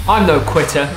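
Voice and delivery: normal talking voice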